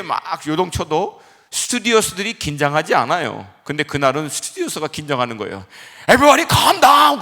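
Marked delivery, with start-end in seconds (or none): 6.1-7.2 loud, high, drawn-out panicky command; tense false calm. Retold or imitated. Preacher imitating flight attendant to frightened passengers, tense panicked shout. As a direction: try to calm everyone while sounding alarmed. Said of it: "자기 목소리가 더 긴장돼 있어서".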